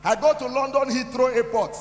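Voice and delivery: preaching at full force. Real, not acted.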